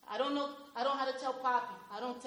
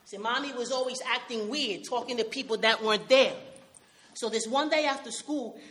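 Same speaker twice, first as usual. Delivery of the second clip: ordinary delivery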